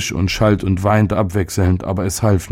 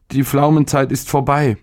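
put-on high, sulky boy grumbling; grudging, sulky complaint; a pouting child grumbling; grumbling sulkily; 6 semitones higher than usual. A narrator's reading. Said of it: "brummte der Junge verdrießlich".

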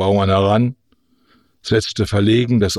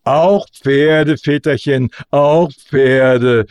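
louder, emphatic, chest-out declaration; proudly assured orator, higher and louder; proud conviction; 4 semitones higher, 5 dB louder, much slower than usual. A narrator's reading. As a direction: proclaim it with full conviction. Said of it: "stolz im Brustton der Überzeugung".

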